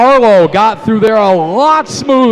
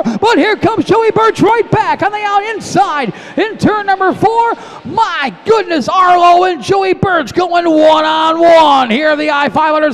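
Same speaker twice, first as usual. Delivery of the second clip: excited race call; 6 semitones higher than usual. Real, not acted. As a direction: call the lead change excitedly.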